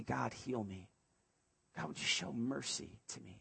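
soft, pleading prayer to God; hushed prayer. Acted. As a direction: say it barely above a breath, like a plea. Congregation voicing a prayer to God.

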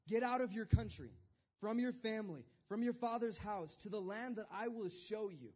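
loud, commanding voice of God. Retold or imitated. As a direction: call him out with divine authority. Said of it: "He says, he says".

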